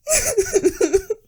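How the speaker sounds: high, nervous giggle; nervous laughter. Real, not acted. Storyteller unsettled by her own creepy tale, nervous laughter. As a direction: giggle helplessly from nerves. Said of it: "nervous laughing".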